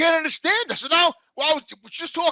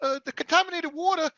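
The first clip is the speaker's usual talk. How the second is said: higher, softer caller voice; lawyer's caller, high and soft; lady caller; 5 semitones higher, 8 dB quieter than usual. Retold or imitated. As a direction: pitch up for the woman on the line. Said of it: "she says".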